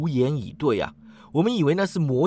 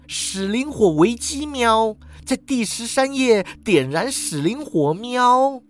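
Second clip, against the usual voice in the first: high, urgent cat announcement; suddenly hissing cat; 7 semitones higher, slower than usual. A narrator's reading. Cat turning on the narrator, suddenly alert. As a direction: announce it sharply, meows pitched high. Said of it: "他突然哈气啊".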